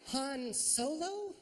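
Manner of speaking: slow, high disbelieving question; incredulous. Shown as a demonstration. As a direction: drag it out in disbelief. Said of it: "Here's another one".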